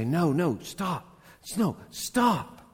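rising, urgent plea to stop; urgent stopping cry. Acted. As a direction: grab someone and stop them mid-step. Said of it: "like taking somebody and stopping them".

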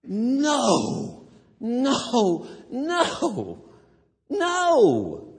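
high, loud repeated refusal; emphatic no. Acted. Preacher answering the obituary with loud high nos. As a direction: reject it forcefully each time. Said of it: "that's what I want to say to this obituary".

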